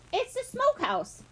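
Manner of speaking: put-on high, curt old man's correction; somewhat gruff. A narrator's reading. Tate correcting Benny about the building, somewhat gruff. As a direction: correct the boy a bit gruffly. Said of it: "Tate said somewhat gruffly".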